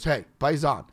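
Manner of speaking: loud, hearty hello across the room; big friendly New York hello; hearty greeting. Retold or imitated. New Yorker greeting him loudly.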